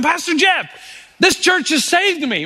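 a hint of a grateful man thanking his pastor; lightly voiced thankful ex-addict. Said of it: "He wanted me to celebrate with him".

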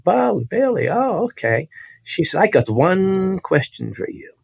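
high, swooping, theatrical voice; imitated woman preacher. Preacher repeating the boy's name and posing a question, high and theatrical. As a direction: imitate her in a high, drawn-out singsong. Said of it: "And she said".

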